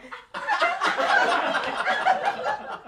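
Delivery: shrieking, breathless burst of laughter; helpless high laugh. Real, not acted. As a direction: lose it completely, laughing. Teller breaks up laughing.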